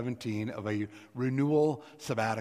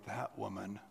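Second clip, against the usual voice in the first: "That woman." muttered much softer, under the breath; 2 semitones lower, 11 dB quieter than usual. Shown as a demonstration. Preacher demonstrating a muttered, disapproving reading. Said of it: "Or it could be like".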